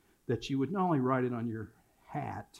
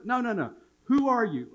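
high-pitched, pressing correction; insistent, raised-pitch challenge; insistent dad quizzing; 9 semitones higher, 3 dB louder than usual. Retold or imitated. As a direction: reject the answer and ask harder. Acted father correcting his son.

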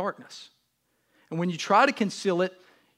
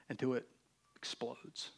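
usual speaking voice

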